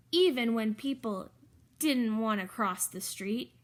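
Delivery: usual speaking voice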